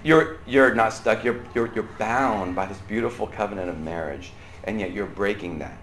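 normal talking voice

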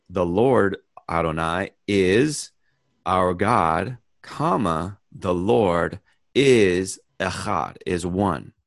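slow, leaning on the word is; emphatic reading. Shown as a demonstration. Teacher reading a second translation, emphatic. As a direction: press is home, slowly. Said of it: "Another version could be".

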